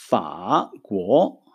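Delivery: פא גו said very slowly with falling tones; slow Chinese demo. Shown as a demonstration. Teacher modeling Chinese for the students, slow. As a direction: pronounce פא גו slowly, tone by tone. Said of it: "מילה הבאה".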